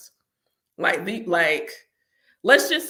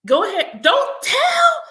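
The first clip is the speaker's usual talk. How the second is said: much higher, louder playful yell; shout; 7 dB louder, slower than usual. Real, not acted. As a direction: holler it at them. Shouting at the Orishas.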